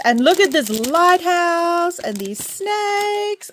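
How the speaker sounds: high, drawn-out gushing; excited. Real, not acted. Showing viewers the lighthouse and snakes, excited. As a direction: stretch it out slowly with high, delighted excitement. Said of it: "I'm so excited to use these".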